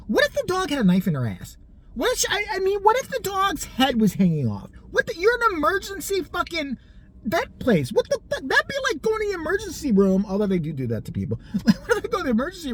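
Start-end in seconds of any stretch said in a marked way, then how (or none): 0.1-10.2 high-pitched indignant disbelief; outraged rant. Retold or imitated. Caller told of five-hour wait at emergency vet, outraged. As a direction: argue incredulously, pitch climbing as you go.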